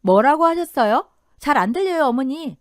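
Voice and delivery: plain reading voice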